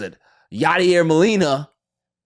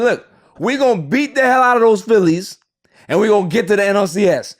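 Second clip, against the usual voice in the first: bold prediction; 8 semitones higher, 7 dB louder than usual. Retold or imitated. Quoting his own bold prediction.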